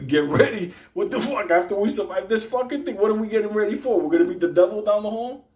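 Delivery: can't believe it; incredulous outrage. Retold or imitated.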